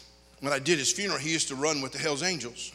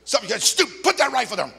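louder, higher stern command; angry order; 7 semitones higher, 5 dB louder than usual. Retold or imitated. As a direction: tell him off hard. Ordering the shooter.

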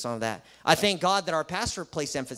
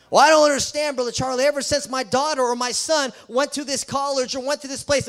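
much higher and louder plaintive parent voice; mock puzzled parent; 6 semitones higher, 5 dB louder than usual. Parent complains to Brother Charlie, much higher and louder. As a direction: whine like a bewildered parent.

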